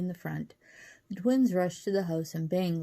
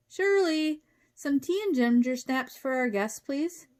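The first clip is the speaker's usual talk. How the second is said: high, projected polite request; calling to wife; 8 semitones higher, 4 dB louder than usual. A narrator's reading. Professor calls wife for refreshments, high and projected. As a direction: sing out to someone in another room.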